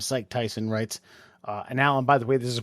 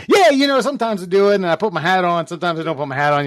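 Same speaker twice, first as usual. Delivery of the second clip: loud, high mock reply starting big, easing down; mimicking a friend; 5 semitones higher, 7 dB louder, faster than usual. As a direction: send up his rambling, over-loud answer.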